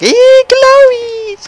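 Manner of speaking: drawn-out, very high squeal; mock squeal. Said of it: "I was like".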